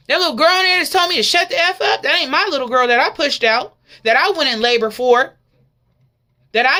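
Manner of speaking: loud, crying plea with a break; desperate mother. Acted. Mother begging Jesus for her daughter, loud and tearful. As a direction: plead as if your child is lost.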